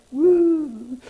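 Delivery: high, loud woo; cheer. Acted. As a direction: whoop with joy. Cheering at laugh.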